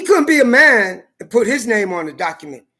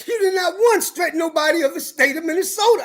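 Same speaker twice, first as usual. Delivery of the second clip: higher, laughing through the quoted words; laughing quote; 7 semitones higher than usual. Retold or imitated. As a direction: retell the friend's line while cracking up. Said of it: "They laughing".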